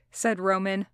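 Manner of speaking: ordinary delivery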